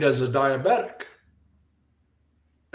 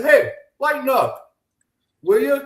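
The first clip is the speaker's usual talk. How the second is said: playful scolding; 9 semitones higher, 4 dB louder than usual. Retold or imitated. Telling off fussy friend.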